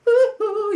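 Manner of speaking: loud, very high "ooh"; falsetto whoop. Pretend. Pop-star whoop while moonwalking figure.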